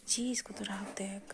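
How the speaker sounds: slow whisper under the breath; whispered aside. Real, not acted. Mother whispers to the other adult about the sulking child, slow hushed aside.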